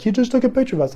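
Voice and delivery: high, alarmed squeal; panicked girlfriend voice; freaking out. Retold or imitated. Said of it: "she really freaked out".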